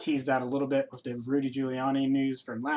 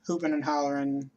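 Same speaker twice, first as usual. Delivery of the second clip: normal talking voice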